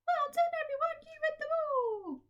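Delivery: very high, squeaky puppet voice full of cheer; a chirpy puppet praising the children; Kathy puppet voice. Acted. To the class, puppet Kathy cheers them on, squeaky and high.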